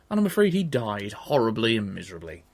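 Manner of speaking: ordinary delivery, nothing marked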